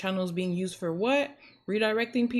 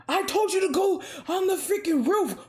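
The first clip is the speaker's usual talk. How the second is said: radio quarrel; 11 semitones higher, 3 dB louder than usual. Retold or imitated. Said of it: "and they're like".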